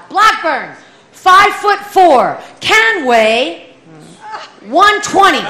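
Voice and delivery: loud, clipped name-and-weight call; mild mock shout of a weigh-in official; shouted roll call. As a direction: bark it out like a drill sergeant. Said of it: "she would shout".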